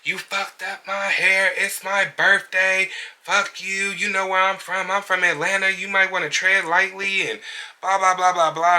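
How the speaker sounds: high-pitched imitation of a yelling client; put-on high voice, mock outraged rant. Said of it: "and she's yelling".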